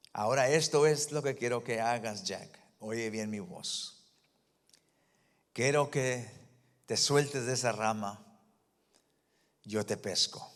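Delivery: grave, suave y pausada; voz de Dios. Retold or imitated. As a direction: habla con calma divina, en voz baja. God's voice speaking to Jack. (Dios le habla a Jack con voz grave y suave)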